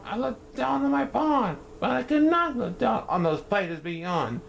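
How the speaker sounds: high, pompous royal voice; puffed-up turtle king. Acted. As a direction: strut your words like a vain little king.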